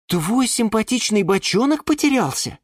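high-pitched sympathetic surprise; dismayed gasp. A narrator's reading. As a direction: react with concerned dismay. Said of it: "охнула она". (охая, высоким голосом)

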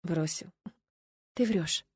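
soft, stunned murmur; hushed whisper. A narrator's reading. Narrator turns cold inside at Milena's news, whispering. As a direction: say it softly, barely believing. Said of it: "прошептала я".

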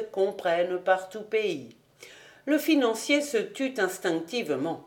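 ordinary delivery

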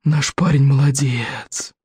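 put-on slow, squeaky old dragon voice. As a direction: praise him in a little squeak. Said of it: "пискнул Один Клык".

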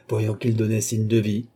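plain reading voice

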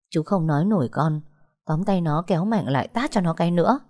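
plain reading voice

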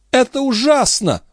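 very high-pitched dismay; dramatic, high exclamation; appalled outburst. A narrator's reading. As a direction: throw it out in horror. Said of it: "бросила графиня".

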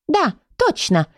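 high, squeaky agreement; squeaked. A narrator's reading. Leonila agrees with the keeper, squeaking. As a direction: pipe up in a little squeak. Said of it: "пропищала Леонила".

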